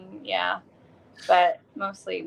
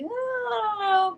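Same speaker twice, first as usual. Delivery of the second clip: hesitant; 14 semitones higher than usual. Retold or imitated. Guest voicing her doubtful moments, high hesitant whine. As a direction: stretch it out high, as if balking.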